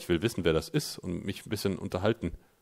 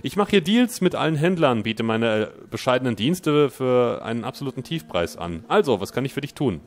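raised, loud salesman pitch; chummy trader voice; 4 semitones higher, 5 dB louder than usual. Acted. Streamer voices trader Dan welcoming the player, salesman cheer. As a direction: greet the customer like a smooth dealer.

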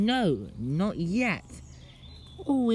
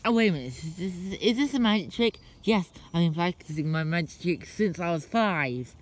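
normal talking voice

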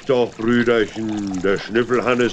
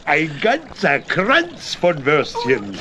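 proud; 6 semitones higher, slower than usual. Acted. Proudly shows the sausages.

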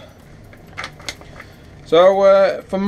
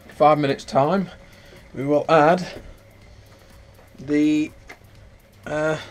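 ordinary delivery

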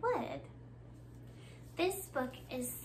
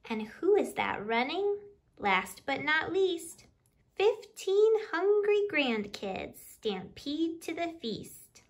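louder, lively picture-book reading in rhyme; reading to children; 6 dB louder than usual. Acted. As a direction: read the counting rhyme out to the little ones with bounce. Reads picture book aloud.

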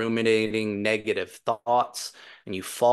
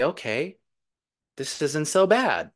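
lightly voiced mind, higher with rising swoops; pleasantly surprised mind coming round, pitched up; mind's voice; 6 semitones higher than usual. Mind speaking up to the meditator as it settles into the heart, lightly voiced. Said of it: "now the mind starts to say".

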